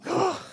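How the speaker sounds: a sudden high anguished ugh; exasperated self-groan; imitated groan. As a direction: groan out loud in embarrassment. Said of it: "I'll be like".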